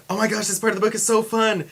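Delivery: loud, high burst of enthusiasm; gushing exclamation, retold; excited. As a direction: blurt it out with acted excitement. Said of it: "or, like, exciting or something".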